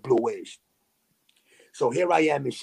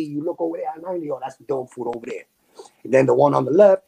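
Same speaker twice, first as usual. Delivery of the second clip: usual speaking voice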